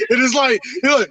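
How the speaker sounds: ordinary talk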